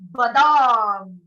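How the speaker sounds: big, slow, loud "बादाम" like a lion. Shown as a demonstration. Teacher demos the almond word like a lion, loud and slow. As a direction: boom "बादाम" out slowly. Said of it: "तो आपने बोलना है".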